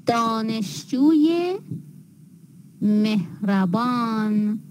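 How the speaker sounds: "دانشجوی مهربان" slowly, with a rising -ye after dâneshju; linking ezafe ye. Shown as a demonstration. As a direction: add a clear "ye" to دانشجوی, pause, then مهربان. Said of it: "مختوم به او".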